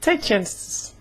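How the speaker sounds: normal talking voice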